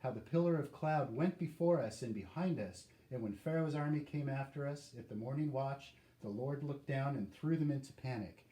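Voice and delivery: ordinary talk, nothing marked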